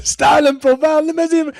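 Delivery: high, loud spitting promo shout; laughing wrestler yell; Ric Flair imitation. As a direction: spit the words like a wrestler. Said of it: "he's, like, spitting".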